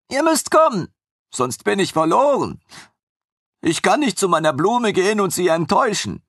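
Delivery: high, gushing voice of the eager servant; devoted young servant. A narrator's reading. Armin gushes to his former master about his happy lioness, high and eager.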